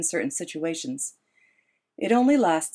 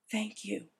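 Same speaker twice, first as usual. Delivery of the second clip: soft, barely voiced thank you; whispered thanks; 4 semitones higher, 10 dB quieter than usual. A narrator's reading. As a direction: breathe it gratefully. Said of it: "Esme whispers".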